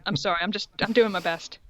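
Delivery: ordinary delivery, nothing marked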